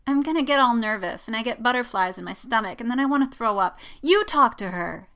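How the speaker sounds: whining. A narrator's reading. Jess begs Poe to talk to Rey, whining. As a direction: whine your way out of it. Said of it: "she whines".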